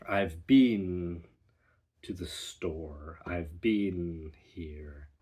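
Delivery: "been" said like "bean", slow; long ee in "been"; British been. Shown as a demonstration. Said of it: "been versus been".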